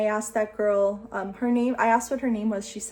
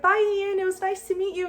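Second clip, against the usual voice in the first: excited goodbye; 10 semitones higher than usual. Retold or imitated. Calling out to Ian passing.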